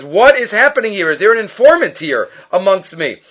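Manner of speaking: ordinary delivery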